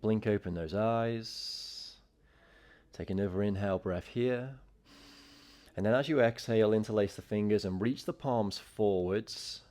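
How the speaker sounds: calm, steady yoga teacher voice; clear, even instructor cues; yoga class cueing. Acted. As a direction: guide the class into the pose. Guided intention and flow cueing.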